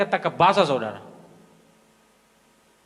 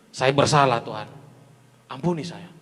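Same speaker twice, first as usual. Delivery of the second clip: humble plea; 8 semitones lower than usual. Acted. King David confesses to God, low and humble. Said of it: "langsung merendahkan diri".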